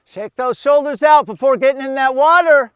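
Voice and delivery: loud, high coaching shout; big outdoor holler; calling out. Real, not acted. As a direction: project the instruction across to the runners. Coach calling out to passing runners.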